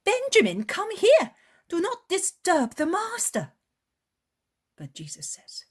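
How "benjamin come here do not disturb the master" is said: put-on much higher, loud calling to her child; mother yelling at her son, high and loud; shouting. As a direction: scold the boy from across the garden. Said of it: "The woman shouts".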